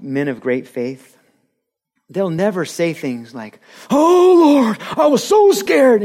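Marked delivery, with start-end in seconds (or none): 3.9-6.0 much higher and louder, hammed-up panic; mock a melodramatic frightened plea; over-the-top fake terror, drawn out; overdramatic fear. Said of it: "overemphasized, drawn out, dramatic".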